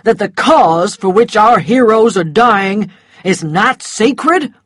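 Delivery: loud, affronted challenge; angry. A narrator's reading. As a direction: demand an answer, offended. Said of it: "an angry voice arguing with him".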